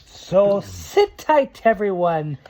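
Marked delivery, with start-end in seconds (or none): none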